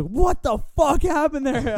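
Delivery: high, slow yelled landlord voice; angry landlord. Retold or imitated. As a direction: bark it like a furious landlord on the phone. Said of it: "He's like".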